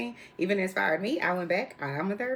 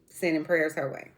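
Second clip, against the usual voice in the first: ordinary delivery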